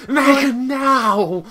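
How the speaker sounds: high, loud, drawn-out mock command; held-back shout. Shown as a demonstration. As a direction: shout it long, as loud as you dare. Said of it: "I can't be too loud, unfortunately".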